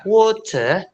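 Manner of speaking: WATER ending "tuh", no r; high, drop the r in WATER. Shown as a demonstration. Teacher modelling British, WATER without final r. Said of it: "nggak usah bilang water".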